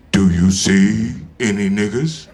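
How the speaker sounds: low, slow, booming voice; voice from above. Retold or imitated. Voice speaking to Pryor in Africa, low and slow. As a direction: speak like an unseen voice of judgment. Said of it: "a voice said to him".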